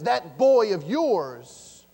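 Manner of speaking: put-on slow, loud, contemptuous that boy; scornful stress. Older son disowning his brother, loud scornful. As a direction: spit out that boy of yours. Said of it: "But this boy says".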